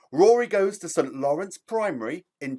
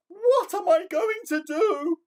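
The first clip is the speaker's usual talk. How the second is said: very high, anxious cry of a worried giraffe; frightened giraffe; slower than usual. Acted. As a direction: lift way up and sound panicked. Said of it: "She was very frightened".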